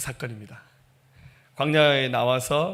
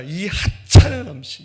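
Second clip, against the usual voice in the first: loud, slow, sneering complaint; scornful complaint; 4 semitones higher, 12 dB louder, slower than usual. Retold or imitated. Israelites scorning the manna, loud and slow. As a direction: spit out the contempt for the food. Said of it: "근데 말합니다".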